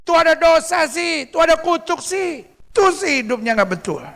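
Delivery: high-pitched mock accusing voice; judgmental mimicry. Onlooker judging someone who suffers, mock accusation.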